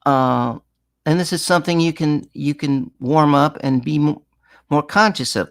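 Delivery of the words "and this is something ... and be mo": ordinary delivery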